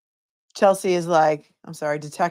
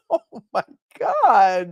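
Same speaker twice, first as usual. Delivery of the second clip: slow, laughing "oh my God"; 8 semitones higher, much slower than usual. Real, not acted. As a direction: laugh through the words. Laughing after the mocked question.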